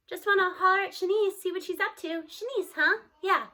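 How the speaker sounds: lightly voiced boy being casual; casual kid voice. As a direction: play it offhand to hide a secret. Scoob voiced casually to grandma.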